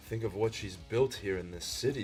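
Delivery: ordinary talk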